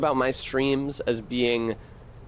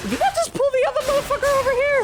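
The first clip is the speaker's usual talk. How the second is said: much higher, fast and louder; shocked disbelief; 5 dB louder, faster than usual. Real, not acted. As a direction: shriek it in disbelief. Shocked at the game.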